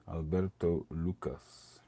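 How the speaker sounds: slow, drawn-out Italian-accented name. Shown as a demonstration. Reading the cigar maker's name in a slow Italian accent. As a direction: roll it out like an Italian. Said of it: "it was an Italian accent".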